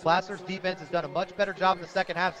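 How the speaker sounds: usual speaking voice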